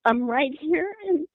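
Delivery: tearful reassurance. Retold or imitated. Mother calls to missing daughter in the trunk, tearful and reassuring. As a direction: coax a child you fear is trapped, holding back tears.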